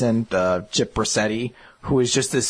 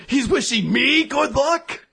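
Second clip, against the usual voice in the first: mock offended gangster; 12 semitones higher, slower than usual. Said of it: "And he's like".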